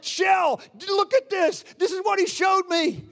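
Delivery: high, urgent re-enacted exclamation; breathless-with-news, high-pitched excitement; excited calling out. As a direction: call out to someone as if just woken from a dream. Excitedly wakes partner after the dream.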